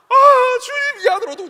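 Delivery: high, loud, wailing prayer cry; fervent praying deaconess. Retold or imitated. Deaconess praying over the boy, with a high loud wail. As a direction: pray at the top of your voice like a fervent old deaconess.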